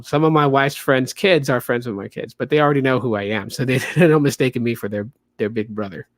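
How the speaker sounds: ordinary talk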